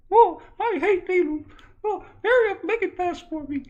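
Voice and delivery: very high, loud nervous squeal; hammed-up needle panic; squeaky dread. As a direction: plead in a high son voice.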